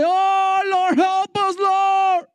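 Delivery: very high, frantic plea; desperate cry to heaven. Retold or imitated. Preacher imagining a frightened plea to heaven, high and desperate. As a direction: cry out upward in mock panic. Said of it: "to heaven and say".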